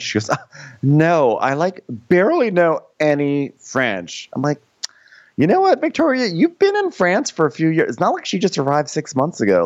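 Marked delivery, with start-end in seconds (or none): 0.3-4.3 imitated airheaded American. Mimicking Victoria's reply. 5.4-9.7 high-pitched exasperated rebuke; pitched-up comic indignation; indignant scolding. Real, not acted. Indignant imagined rebuke to Victoria.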